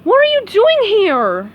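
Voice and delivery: high, loud demanding yell; startled cartoon starfish yelling; startled shout. Patrick woken in bed yells at intruding SpongeBob.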